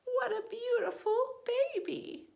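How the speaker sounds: father bird marvelling at his new chick; delighted shout. Acted. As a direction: beam with pride as you call it out. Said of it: "he shouted".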